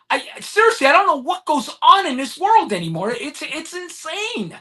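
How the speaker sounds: real exasperation, pitched high; worked up, dragging out the last words; getting mad. Real, not acted. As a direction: voice climbing in disbelief as anger builds. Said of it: "trying not to get mad".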